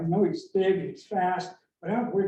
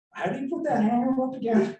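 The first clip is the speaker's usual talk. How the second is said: confused customer; 7 semitones higher than usual. Retold or imitated. Mock confused customer question.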